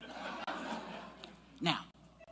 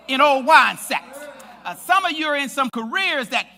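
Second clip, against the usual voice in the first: louder building sermon application; preaching at full force; 4 semitones higher, 8 dB louder than usual. Acted. Preacher pressing his listeners about their stalled lives, building in force. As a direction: drive the point home to the congregation.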